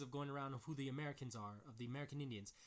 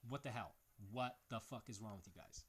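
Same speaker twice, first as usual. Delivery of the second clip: ordinary talk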